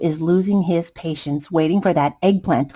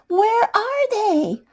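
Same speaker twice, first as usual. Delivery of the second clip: desperate; 13 semitones higher, slower than usual. Acted. Potato arrives too late.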